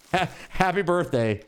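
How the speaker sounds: normal talking voice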